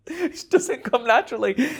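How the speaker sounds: high laugh that carries into the words. Real, not acted. Laughing at how hard playing straight was.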